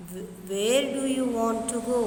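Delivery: loud, high where; emphatic question. Shown as a demonstration. Teacher reading a question aloud, emphatic. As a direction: ask it out strongly. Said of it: "This is correct".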